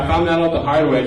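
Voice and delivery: normal talking voice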